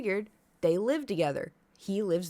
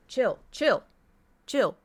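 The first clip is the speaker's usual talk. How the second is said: firm scolding; 4 semitones higher, 5 dB louder, slower than usual. Real, not acted. Scolding her dog.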